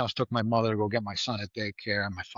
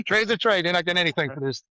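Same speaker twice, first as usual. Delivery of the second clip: much higher and louder, laughing through it; laughing Danny Lean impression; 7 semitones higher, 4 dB louder, faster than usual. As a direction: repeat it cracking up. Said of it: "there's Danny Lean going".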